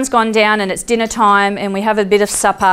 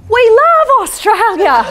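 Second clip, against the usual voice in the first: high, loud, slow and beaming; delighted; 13 semitones higher, 5 dB louder, slower than usual. Retold or imitated. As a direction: say it with joyful welcome. Man greets warmly.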